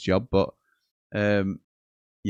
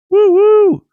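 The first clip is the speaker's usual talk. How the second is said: very high, loud siren wail; mimicking the police siren song; 14 dB louder than usual. Shown as a demonstration. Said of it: "It's the sound of the police".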